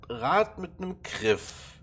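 ordinary talk

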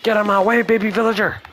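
high, loud shout to clear the way; yelling at villager. Real, not acted. Shooing villager in game.

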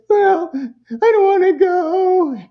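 high, slow, teary whine; whiny crying child. Retold or imitated. Kid at drop-off whining tearfully, high and drawn out. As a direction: drag it out like a sulking kid.

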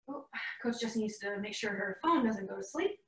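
muttered to herself, soft; a low under-the-breath aside; quiet aside. Real, not acted. Muttered aside while fixing phone.

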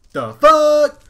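very high, very loud shriek; shocked shout. Acted. Startled prince shouts at the bear, very high and loud. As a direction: scream it at the bear in the window. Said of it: "shouted Spid in shock".

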